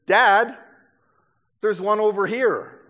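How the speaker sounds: put-on loud, high-pitched boy calling out; son calling Dad. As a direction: call out to Dad like an excited kid. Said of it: "one of my sons".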